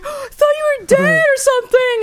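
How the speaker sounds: very high, tearful imitated wail; mock crying mom. Mom after collecting her kid, hammed-up crying. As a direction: imitate a mother still in tears. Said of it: "fucking still crying".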